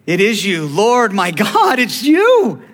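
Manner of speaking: rising, drawn-out exclamation of recognition; falling apart in awe. Acted. Thomas recognizing the risen Lord, overwhelmed outcry. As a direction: build from stunned recognition to a high, loud cry. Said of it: "Thomas fell apart".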